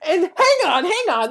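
high, laughing, excited rush; giddy excitement. Acted. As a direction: get carried away laughing. Excited pitch mid-pitch.

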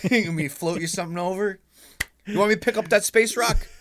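high-pitched, keen offer; over-eager helper. Acted. Canadian with arms offering help in space, high and over-eager. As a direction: pipe up, pleading to be useful.

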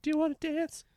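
ghost lady voice. Acted. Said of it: "lonely single ghost lady".

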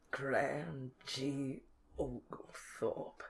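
slow, low, dark delivery; ominous. Acted. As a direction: savour the name like a villain. Ominous name reveal.

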